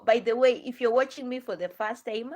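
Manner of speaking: ordinary talk, nothing marked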